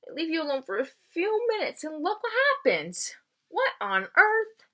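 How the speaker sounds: high squawking crow voice, slowing on what on earth; crow crying out. Acted. Crow Bongo scolding the tree, high and squawky. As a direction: play the bird, shocked and scolding. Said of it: "she cried".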